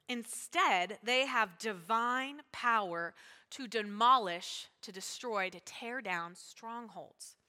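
slow, emphatic Bible reading; scripture reading. Acted. Preacher reads to congregation, slow emphatic scripture. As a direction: read the verse slowly and deliberately.

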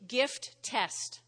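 normal talking voice

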